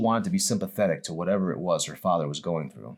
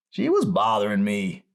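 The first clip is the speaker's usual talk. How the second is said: raspy voice; 4 semitones higher, 5 dB louder than usual. Acted. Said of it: "in a raspy voice".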